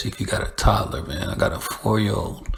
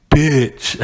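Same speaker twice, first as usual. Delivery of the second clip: sassy homegirl; 5 semitones higher, 4 dB louder than usual. Retold or imitated. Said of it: "Sometimes they be like".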